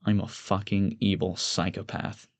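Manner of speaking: slow, very soft confession; whispering to oneself. A narrator's reading. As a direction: murmur it slowly, for nobody else. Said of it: "I whisper to myself".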